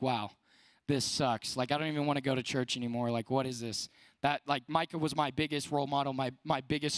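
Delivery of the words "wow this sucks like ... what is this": low, deflated, a touch of hammed-up misery; mock dejected groan. Youth speaker replaying his pastor moving away, lightly mock-sad. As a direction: sulk it like a let-down seventh grader. Retelling being crushed when Micah left.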